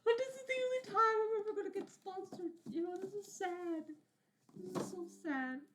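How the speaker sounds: high falsetto mock sadness; mock squeaky whine. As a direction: whine it like a sad little cartoon. Squeaky self-mocking voice.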